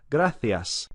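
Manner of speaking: gracias said grathias, th for the c; Castilian Spanish gracias with th; Spain accent. Shown as a demonstration. Teacher shows the Spain th sound. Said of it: "Very typical Spanish accent would be that th".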